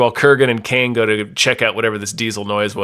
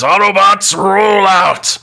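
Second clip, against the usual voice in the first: Optimus Prime battle cry; 8 semitones higher, 5 dB louder, slower than usual. Acted. Truck announces itself.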